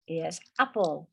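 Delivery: ordinary talk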